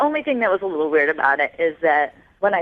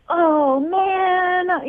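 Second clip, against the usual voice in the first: high, drawn-out imitated groan of dismay; mock dismay; 12 semitones higher than usual. Caller retelling how her date bolted, mock dismay. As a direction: imitate someone hamming up disappointment. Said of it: "he kind of was like".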